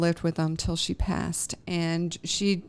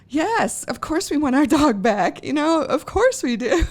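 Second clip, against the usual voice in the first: high and loud, gushing agreement; emotional yes; 8 semitones higher, 7 dB louder than usual. Retold or imitated. Agreeing to get the dog back, gushing and emotional. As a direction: blurt it out, overcome. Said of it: "you're just like".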